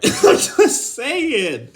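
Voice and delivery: high, loud, drawn-out through laughter; laughing defence. Real, not acted. Excusing his dark joke, high laughing protest. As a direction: stretch it out slowly while cracking up.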